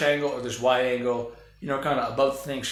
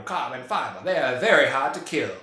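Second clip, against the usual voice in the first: put-on accent with dropped r's; Stephen Lang; 4 dB louder than usual. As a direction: imitate a tough movie character's accent, leaning on "very". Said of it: "That's my, uh, Stephen Lang accent".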